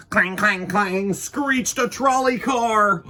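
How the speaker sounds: loud high clanging; trolley clang. A narrator's reading. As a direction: ring it out like a trolley bell. Imitating trolley bell.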